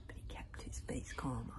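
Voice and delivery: usual speaking voice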